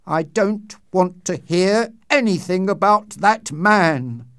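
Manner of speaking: loud and high, voice shaking; slow, loud and trembling with fear. A narrator's reading. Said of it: "in a loud, trembling voice".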